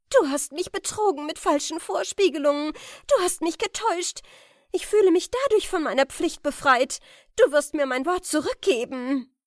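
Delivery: loud, high accusing voice; angry reproach. Acted. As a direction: hurl the betrayal at him, loud and high. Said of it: "rief Jokunde". (zornig anklagend, laut und hoch)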